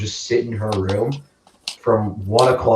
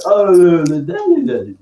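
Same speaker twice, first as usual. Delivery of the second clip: higher, louder happy-go-lucky babble; carefree mimicry; 8 semitones higher, 6 dB louder than usual. Friend cheerful ten minutes after sulking, carefree. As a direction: imitate a friend blithely humming along.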